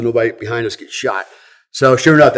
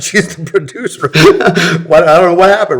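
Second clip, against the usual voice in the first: laughing; 5 semitones higher, 6 dB louder than usual. Real, not acted. Laughing over his own story.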